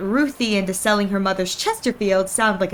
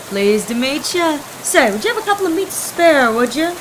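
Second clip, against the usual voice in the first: easygoing hobo Gus; 6 semitones higher, 3 dB louder than usual. Acted. Gus greets, asks for spare change.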